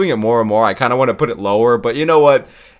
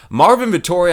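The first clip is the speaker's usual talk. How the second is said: ordinary talk, nothing marked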